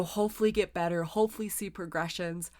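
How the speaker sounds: words stretched by a yawn. Real, not acted. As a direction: talk through a yawn. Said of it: "I'm yawning".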